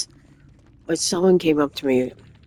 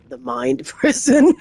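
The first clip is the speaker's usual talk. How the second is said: laughing through the words; 9 semitones higher, 4 dB louder than usual. Real, not acted. Trying to recall a saying, laughing midway. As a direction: let a laugh break into the phrase.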